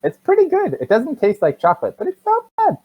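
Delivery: put-on high, chatty little-kid voice; small child. Small child telling the barbarian about the food, high child voice.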